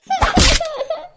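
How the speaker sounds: a loud, high mocking laugh. Older sister laughing at the scolded girl, mocking laugh. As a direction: cackle at her sister's trouble.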